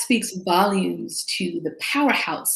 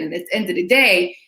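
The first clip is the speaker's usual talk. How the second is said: ordinary talk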